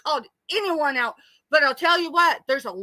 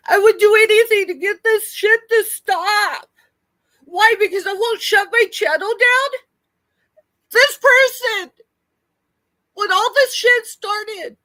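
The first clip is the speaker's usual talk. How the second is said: upset outburst; 5 semitones higher, 5 dB louder than usual. Real, not acted.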